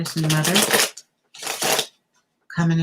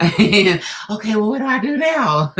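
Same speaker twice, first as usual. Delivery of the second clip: high, loud Hello then a gasp; mock excited client; 5 semitones higher, 7 dB louder than usual. Reader playing the viewer meeting a new lover, mock excited. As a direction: act out the flustered, overjoyed viewer. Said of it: "You're like".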